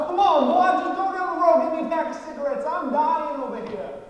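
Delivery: hammed-up southern begging, loud; mock southern man loudly whining for his smokes; deep loud southern voice. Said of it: "his voice would get real deep, real southern, real loud".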